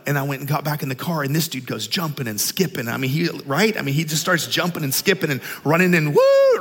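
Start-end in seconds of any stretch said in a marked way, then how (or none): 6.1-6.6 loud, high "woo" of celebration; imitated joyful shout; Holy Ghost whoop. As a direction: whoop like a Pentecostal runner. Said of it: "like, shouting and Holy Ghost".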